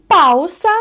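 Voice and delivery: Spanish pausa; Spanish model word. Shown as a demonstration. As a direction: model пауса clearly. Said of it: "спробуємо прочитати слова".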